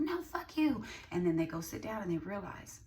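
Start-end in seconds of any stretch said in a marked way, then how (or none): 0.0-0.8 high mock angry retort; hammed-up dismissive shout-down; mock snap. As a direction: throw it back, pitched high. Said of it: "they're like".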